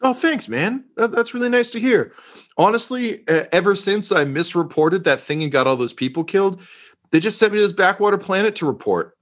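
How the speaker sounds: eager, pleased to be praised; reporter voice. Acted. Reporter NPC thanks commissar.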